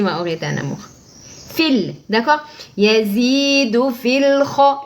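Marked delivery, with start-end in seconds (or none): 2.8-4.8 slow chanted "Yazidu fil kha" with a heavy emphatic kha; Quranic recitation. Shown as a demonstration. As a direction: tajwid demo, drawn out. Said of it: "Et le kha, attention, l'emphatique".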